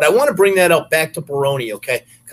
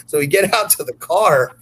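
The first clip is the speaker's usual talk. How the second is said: ordinary talk, nothing marked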